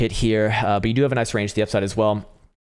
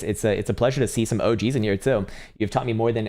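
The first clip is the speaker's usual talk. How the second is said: usual speaking voice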